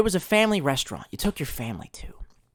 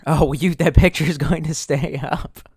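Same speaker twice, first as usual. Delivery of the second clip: usual speaking voice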